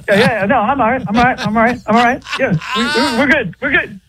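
high, twitchy Bubbles voice insisting all is right; Bubbles impression. Teasing the host by voicing Bubbles reassuring everyone. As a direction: do Bubbles from The Wire, jittery and pleading. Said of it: "that's who he sounded like".

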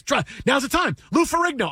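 high-pitched mock urging; retold eager urging. Best friend urges narrator after happy hour, eager urging.

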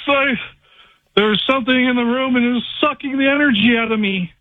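mock-panicked report; retold alarm. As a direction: act out blurting a scare to your wife. Retelling alarm to his wife.

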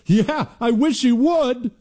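high, slow mocking voice, lightly done; mocking agreement. Host mimics Democrats wishing harm, high slow mockery. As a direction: sneer it out slowly in a high pitch, imitating. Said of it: "That's how they talk".

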